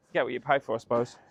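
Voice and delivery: usual speaking voice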